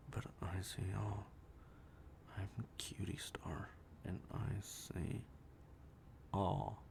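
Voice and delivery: low, slow whispered oracle line; ominous whisper. Pretend. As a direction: whisper it like a creepy seer.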